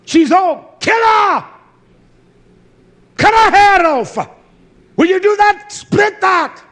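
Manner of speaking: high, loud barking orders in a parody of cruelty; mock furious shout. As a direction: scream the orders like a raging tyrant. Shouted parody of brutal commands.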